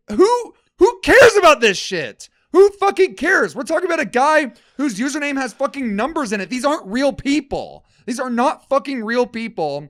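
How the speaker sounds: high, loud exasperated rant, building to a yell; ranting shout. Real, not acted. Ranting to his cohost about YouTube commentary channels, a loud exasperated yell. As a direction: blow up at the whole idea, shouting.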